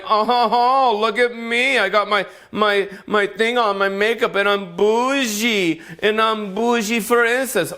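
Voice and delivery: high mocking snooty voice; bougie snob. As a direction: ham up a stuck-up rich gym lady. Mocking bougie gym people.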